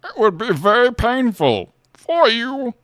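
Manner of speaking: measured menace, stretched out; Bane impression. Quoting his favourite Bane line, slow and theatrical. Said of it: "God, I love Bane lines".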